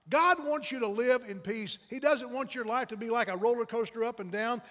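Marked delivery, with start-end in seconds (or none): none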